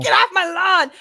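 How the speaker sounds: a hint of a cranky old man shouting; mock old-man yelling off the lawn; grumpy old man.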